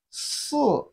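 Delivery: "si" starting high, dropping sharply; long s, then let "si" fall from high. Shown as a demonstration. Teacher models Chinese four for learners, falling "si". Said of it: "is the falling tone".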